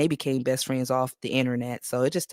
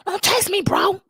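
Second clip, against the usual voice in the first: high mock voice for Don't text me; hammed-up high imitation; mocking quote; 14 semitones higher, slower than usual. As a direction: mimic her up high, mocking. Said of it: "She said".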